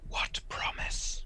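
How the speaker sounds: slow, eerie whisper from the darkness; ominous whisper. Acted. Hidden visitor whispers to the old man, ominous. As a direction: breathe it out like a threat in the dark. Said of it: "try and do as ominous a whisper as I can".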